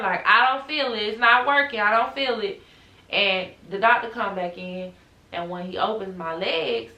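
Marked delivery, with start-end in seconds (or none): 0.3-2.6 louder, insistent retold yell. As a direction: yell at the nurse it's not working. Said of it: "So I'm just yelling at her".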